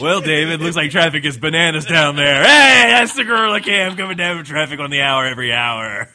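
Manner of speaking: loud, hammed-up helicopter traffic report; radio traffic reporter. Gorilla traffic reporter bit.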